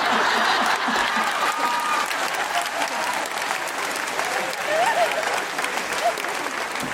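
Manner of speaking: long, high, airless cackle; long helpless high-pitched laughter; high squealing laugh. Real, not acted. Cracking up at her confession.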